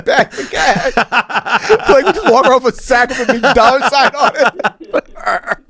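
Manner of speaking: high-pitched, laughing all the way through. Real, not acted. Podcast host riffing on bags of cash, laughing. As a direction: talk through helpless laughter. Cracking up at own joke.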